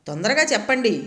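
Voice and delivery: ordinary delivery, nothing marked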